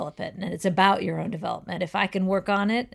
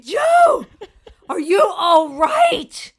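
high, loud frantic cry with a laugh breaking in; frantic shout; 10 semitones higher, 9 dB louder, much slower than usual. Acted. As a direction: yell it, cracking up as you go. Friend shouting, half laughing.

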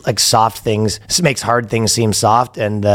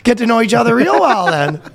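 higher-pitched mock Canadian; Canadian accent; 10 semitones higher, faster than usual. Guy answers officers at his door, Canadian accent. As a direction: push back in a hammed-up Canadian accent. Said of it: "the most Canadian interaction".

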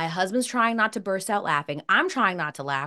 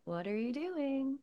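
fake-sweet toddler; 6 semitones higher, 10 dB quieter, slower than usual. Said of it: "horribly fake smiles".

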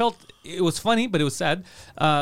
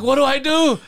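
higher, louder baffled question; retold mock panic; flustered; 7 semitones higher, 6 dB louder than usual. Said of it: "And I'm like".

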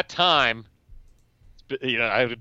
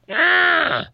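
sudden high-pitched "Ah!"; mock yell; 5 dB louder than usual. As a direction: cap the rant with a high yell. Cry capping the mock rant.

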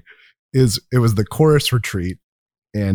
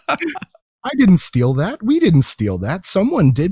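high, drawn-out imitated protest; indignant protest; 9 semitones higher, slower than usual. Student refusing to pay for stolen player, imitated indignation. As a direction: protest innocence in a raised, slow voice.

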